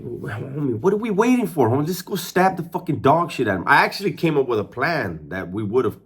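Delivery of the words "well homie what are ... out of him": high-pitched, exasperated push to act; retold impatient plea; impatient urging. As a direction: urge a hesitant friend on, voice jumping up. Impatiently urging friend to attack.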